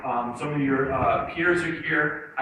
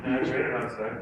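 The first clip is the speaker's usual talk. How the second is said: glum reply; 4 semitones lower than usual. Acted. Student replying to a Monday greeting, glum. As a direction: answer as if the day is already lost.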